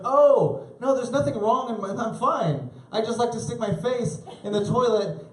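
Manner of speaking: put-on high, sweet innocent kid. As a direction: play it totally innocent.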